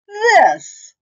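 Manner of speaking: high, loud monster cry; loud high germ reveal. Acted. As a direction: shriek it like a monster.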